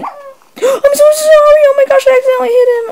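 high, tearful wail of sorry; crying apology. Real, not acted. After hitting her own dog, a high tearful wail.

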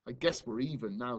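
plain reading voice

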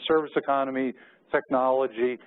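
normal talking voice

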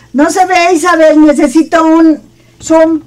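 high, loud call to the helper. Real, not acted. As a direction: call out for help from the camerawoman. Calls camerawoman for a zoom.

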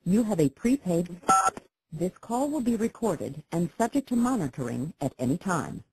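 voice assistant prompt. Acted. Automated prison phone system prompt.

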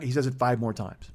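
ordinary talk, nothing marked

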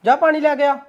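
husband scolding wife for no water; annoyed scolding. Acted. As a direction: bark orders at your wife.

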